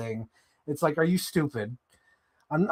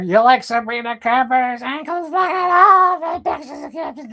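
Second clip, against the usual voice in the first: much higher and louder, eager pushing; pushy algorithm voice; 10 semitones higher, 6 dB louder than usual. Retold or imitated. The algorithm offering ankle pictures, high eager squeal. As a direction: squeal it like an overeager salesman. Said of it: "it'll be like".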